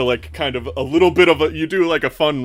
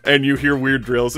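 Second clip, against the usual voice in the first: usual speaking voice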